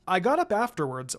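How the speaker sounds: ordinary delivery, nothing marked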